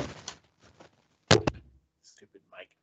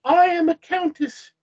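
high-voiced haughty countess; high English lady voice; cold brown lady; 10 semitones higher, 4 dB louder than usual. A narrator's reading. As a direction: announce rank in a high, lofty voice. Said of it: "socially cold but normal voice".

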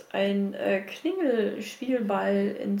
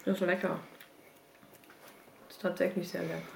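mouth full; 5 semitones lower, 9 dB quieter, faster than usual. Real, not acted. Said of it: "ich esse wieder eine Kartoffel zwischendurch".

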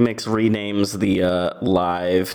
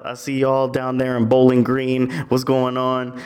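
higher, upbeat livestream patter; hyped live streamer; 4 semitones higher, 3 dB louder than usual. Acted. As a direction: greet the chat like an influencer on live. Plays live streamer greeting viewers.

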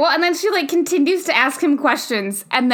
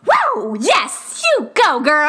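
forceful self-cheering; much slower than usual. Retold or imitated. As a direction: whoop it up as if cheering yourself on. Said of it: "cheering herself on forcefully".

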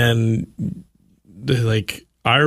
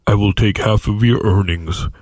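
God voice; 3 semitones lower than usual. Pretend. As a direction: pass judgment like a stern God. God speaking to Zach.

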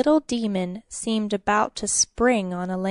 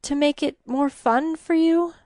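higher, strained coaxing offer; forced composure; 6 semitones higher than usual. A narrator's reading. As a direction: coax it while holding back a sob. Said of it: "with an effort regained her composure".

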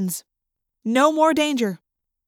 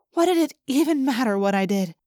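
usual speaking voice

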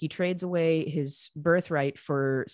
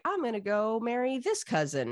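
lightly acted announcement, raised pitch; breezy Jacob; 6 semitones higher than usual. Younger brother declaring his marriage plan, lightly acted. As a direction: announce it simply, stressing "this". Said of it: "And Jacob's like".

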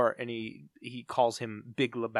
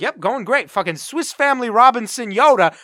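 smug; 9 semitones higher, 12 dB louder than usual. Retold or imitated. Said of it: "looking down like".

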